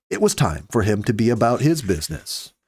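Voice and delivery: ordinary delivery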